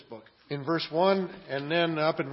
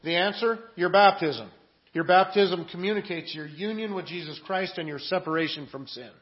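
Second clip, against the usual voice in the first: normal talking voice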